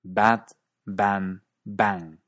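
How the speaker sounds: BAT, BAN, BANG in British English, one open 'a' throughout; British English accent. Shown as a demonstration. Teacher demonstrating an accent to students, British BAT, BAN, BANG. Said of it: "En cierto inglés británico".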